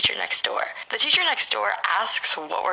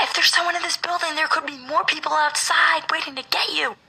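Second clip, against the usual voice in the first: retold scream; 8 semitones higher than usual. Teacher warns kids trying to jump out the window, mock screaming. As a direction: imitate an urgent teacher yelling, pitched up. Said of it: "My teacher was screaming at them".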